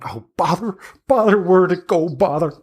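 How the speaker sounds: a crone grumbling to herself; muttering hag. Acted. Hag rummages in her backpack.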